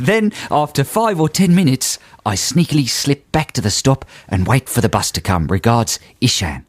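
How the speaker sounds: put-on Aussie accent for a letter; comic Australian letter-reading. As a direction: read it out as a mock Australian. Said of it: "carry on in the correct accent".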